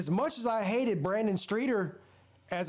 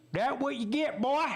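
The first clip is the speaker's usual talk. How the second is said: mocking taunt; 7 semitones higher than usual. Fan taunting the injured quarterback.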